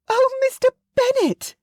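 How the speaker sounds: very high, loud, excited squeal of a mother; shrill Missus Bennet scream. Acted. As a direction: screech it like an overjoyed, fussy mother. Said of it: "Missus Bennet screamed".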